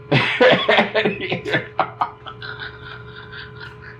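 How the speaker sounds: airy laugh at the punchline; real breathy laughter; long breathy laugh. Real, not acted. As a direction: laugh out at the mixup.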